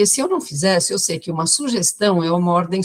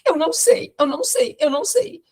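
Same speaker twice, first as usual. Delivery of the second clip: eu não sei three times, pitched much higher; high pleading repetition; 10 semitones higher than usual. Retold or imitated. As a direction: mimic the patient's worried triple echo. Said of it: "Tudo que ela falava eram três vezes". (voz aguda imitando a paciente repetindo)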